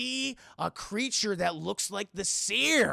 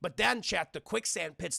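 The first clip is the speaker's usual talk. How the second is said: usual speaking voice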